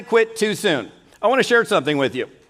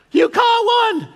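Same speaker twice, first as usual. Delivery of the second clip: astonished shout; slower than usual. Retold or imitated. Wife spotting her husband's first steelhead, shouting in amazed delight. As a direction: shriek it out in joyful disbelief.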